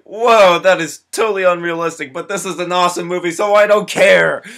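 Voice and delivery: loud, high, gushing whoa; high and loud, rushing through the middle; thrilled outburst. Retold or imitated. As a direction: rave about it with giddy delight. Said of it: "And I was like".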